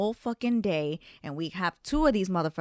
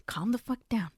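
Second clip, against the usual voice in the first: urgent whispered self-calming; whispering to herself; 3 semitones higher than usual. Retold or imitated. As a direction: hiss it under your breath to calm yourself. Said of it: "this is how I had to talk to myself".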